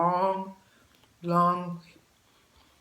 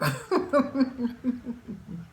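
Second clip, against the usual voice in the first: bubbly laugh; 5 semitones higher than usual. Real, not acted. Laughs at her own joke.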